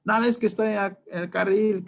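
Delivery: high-pitched mock shout; imitated yelling driver. Driver shouting at the one who cut him off, high mock shout. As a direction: yell like a furious driver. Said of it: "te van a gritar de todo".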